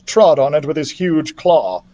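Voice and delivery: ordinary delivery, nothing marked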